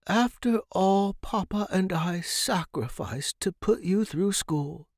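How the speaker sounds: lightly voiced reproachful mother; cold mother voice. A narrator's reading. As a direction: look down your nose at your son. Disapproving mother's remembered reproach.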